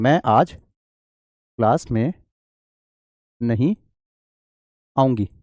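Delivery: word by word, very slow, as if writing on the board; slow dictation. Shown as a demonstration. Teacher writing on the board for students, slow dictation. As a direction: drag each word out while writing it up. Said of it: "पहले तो direct speech में लिख के दिखाता हूं".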